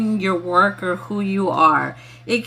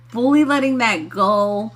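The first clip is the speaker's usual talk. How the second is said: usual speaking voice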